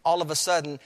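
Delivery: ordinary delivery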